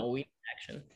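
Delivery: low, quiet, no-nonsense. Retold or imitated. Teacher showing how her voice changed, low and serious. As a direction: drop the voice down to business. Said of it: "Even my voice changed in that".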